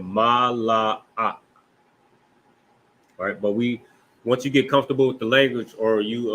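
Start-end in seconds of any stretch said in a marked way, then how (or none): 0.0-1.0 Malak drawn out as ma, la, ak; syllable-by-syllable. Shown as a demonstration. Teacher showing how you pronounce it, Malak syllable by syllable. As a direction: say Malak in three separate long syllables. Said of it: "That's how you pronounce it".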